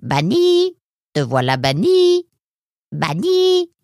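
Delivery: parrot voice. A narrator's reading. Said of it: "Puis il reprit son monologue".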